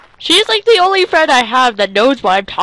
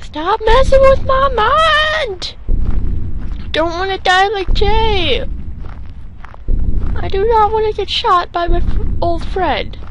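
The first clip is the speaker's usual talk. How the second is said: panicked yell; 8 semitones higher than usual. Real, not acted. Scared player yelling at game.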